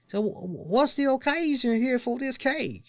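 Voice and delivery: high, drawled delivery man voice; cake guy impression. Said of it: "this is exactly how he sounded".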